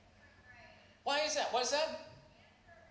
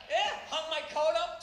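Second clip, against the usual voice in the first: ordinary talk, nothing marked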